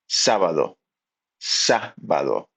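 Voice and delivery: Sábado with a strong stress on the first syllable, SÁ-ba-do. Shown as a demonstration. Teacher showing students the stressed first syllable. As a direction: hit the SÁ in Sábado. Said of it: "really accentuate it".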